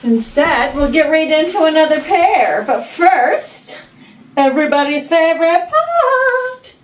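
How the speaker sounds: loud, high singsong build-up; excited announcer. Acted. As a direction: hype up the big segment. Announcing favorite segment, singsong.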